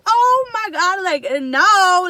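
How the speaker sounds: loud, high "oh my God"; retold dismay. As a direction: exclaim in dramatic dismay. Retelling shock at the dirty car.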